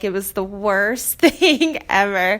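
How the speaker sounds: usual speaking voice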